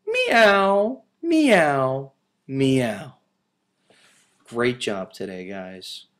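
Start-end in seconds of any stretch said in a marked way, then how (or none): 0.1-3.2 kitten meow. Acted. Kittens cry, third reading.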